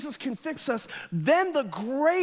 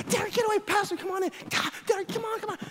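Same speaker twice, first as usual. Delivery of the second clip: higher, flustered shooing, fading softer; embarrassed mom; 7 semitones higher, 3 dB quieter, faster than usual. Retold or imitated. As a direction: hurry the kid away, flustered. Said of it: "my mom was so embarrassed".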